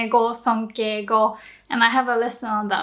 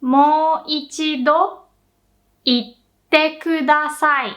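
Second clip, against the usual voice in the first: very slow Mo ichido, a pause, then itte kudasai; slow model phrase; 3 semitones higher, 3 dB louder, slower than usual. Shown as a demonstration. Teacher breaking the phrase into two slow halves for learners. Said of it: "you'd say".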